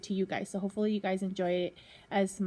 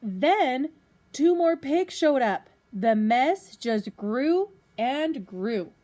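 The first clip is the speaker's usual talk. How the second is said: lively storybook reading to a class; reading to children; 3 semitones higher, 8 dB louder, slower than usual. Acted. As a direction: read it out like a teacher at story time. Teacher reads picture book to class.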